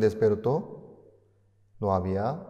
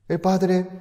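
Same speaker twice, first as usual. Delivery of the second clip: ordinary delivery, nothing marked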